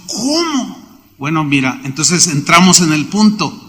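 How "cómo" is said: pregunta aguda y en voz baja; incredulidad imitada. Retold or imitated. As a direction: imitar a alguien desconcertado, subiendo el tono. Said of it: "me miraba así, como diciendo". (incrédulo, fingiendo extrañeza)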